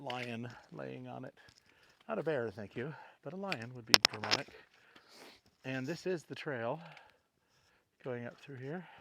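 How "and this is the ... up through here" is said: out of breath. Real, not acted. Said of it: "sorry for all the huffing and puffing".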